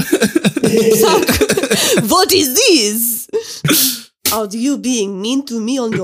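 mock French accent; hammy offended French voice; french chef. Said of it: "Are you, are you still Felix?".

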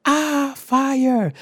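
high, loud mock shriek of alarm; dramatic scream. Classmate at the back spots microwave fire, dramatic scream. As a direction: scream it in over-the-top panic. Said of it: "the most dramatic ass scream".

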